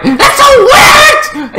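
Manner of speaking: long, high screech; mock outrage. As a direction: shriek it, drawn out, in disbelief.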